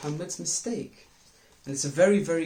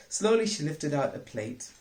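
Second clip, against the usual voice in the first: ordinary delivery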